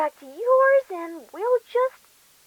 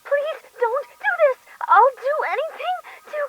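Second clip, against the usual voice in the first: screaming plea; 8 semitones higher than usual. Acted. Victim screaming in pain.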